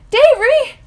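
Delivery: sudden high, loud yelp. Acted. Girl grabbing her brother's arm, yelping his name. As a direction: cry out the name in alarm. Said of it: "I yelp".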